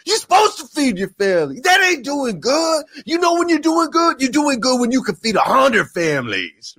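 full-tilt pumped-up preacher shout; very high-pitched, strained yell; Eric Thomas impression. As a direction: imitate a hip hop preacher screaming a challenge. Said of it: "He gets all pumped up".

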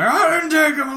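high put-on old man shout; old man yelling. Thief yelling back at the clerk in a mock old man voice. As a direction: yell like a cranky old man. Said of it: "yelling in an old man voice".

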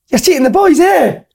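high squeaky mock yell; mock shouting coach. Retelling the Falkirk guy yelling at the ref, high mocking shout. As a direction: ham up a ranting touchline guy. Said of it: "he was shouting non-stop the full game".

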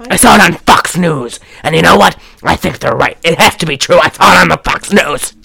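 worked-up mock rant about grievances; lunatic conspiracy theorist. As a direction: imitate an indignant crank in full tirade. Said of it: "if I sounded like a lunatic".

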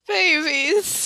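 high tearful coo rising at end. Real, not acted. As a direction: coo it, half crying. Gushing over characters.

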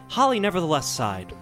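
ordinary talk, nothing marked

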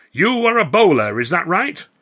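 high, loud theatrical questioning; showman clown. A narrator's reading. Clown quizzes volunteer bowler before the crowd, theatrically loud. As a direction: play it out to the whole circus.

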